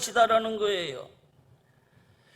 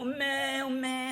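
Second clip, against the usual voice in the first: imitated sheep bleat; 3 semitones higher, 7 dB quieter than usual. Preacher voices a lost sheep stuck in a pit, imitated bleating. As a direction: lightly imitate a sheep crying for help. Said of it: "하고 울면서 살려달라고 소리를 질렀을".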